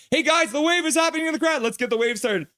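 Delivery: high-pitched excited calling out; hyped-up holler to get everyone going; rallying the crowd. Pretend. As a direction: call out up high to a whole arena.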